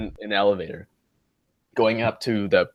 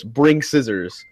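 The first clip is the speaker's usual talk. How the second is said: deadpan slow quote; much slower than usual. Retold or imitated. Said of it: "he goes".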